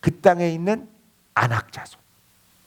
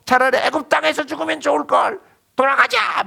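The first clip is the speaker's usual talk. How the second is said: resentful outcry; 11 semitones higher than usual. Retold or imitated. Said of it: "모세, 아론 원망하고".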